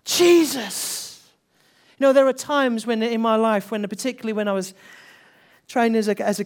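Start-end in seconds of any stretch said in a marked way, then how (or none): 0.0-1.0 loud, higher cry of the name; declaring in faith. Retold or imitated. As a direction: call his name out boldly, slowly. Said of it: "had a voice to declare in faith".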